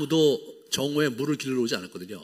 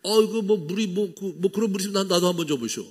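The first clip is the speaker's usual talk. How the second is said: flustered irritation; 6 semitones higher than usual. Retold or imitated. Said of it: "이렇게 막 이렇게 화가 나갖고".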